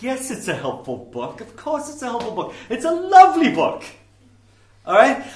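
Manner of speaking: building to a high, loud gush; mock enthusiasm, climbing in pitch to a loud finish; hammed-up delighted clergyman; gushing liberal minister. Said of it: "This is what you'll get from one of those guys".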